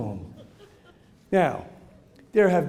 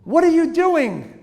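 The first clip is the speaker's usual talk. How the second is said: higher, louder, slow demand; mock stern teacher; a teacher confronting a child; stern teacher question; 10 semitones higher, 8 dB louder, slower than usual.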